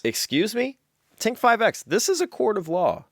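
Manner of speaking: hammed-up courtroom outrage; indignant. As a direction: scold in scandalized disbelief. Judge scolds the heckling gadget.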